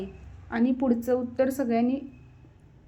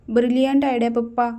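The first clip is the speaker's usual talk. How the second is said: ordinary delivery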